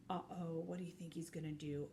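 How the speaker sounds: low, hushed near-whisper; conspiratorial storytime question; suspenseful aside. A narrator's reading. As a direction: whisper a worry to young listeners. Reader builds suspense for children.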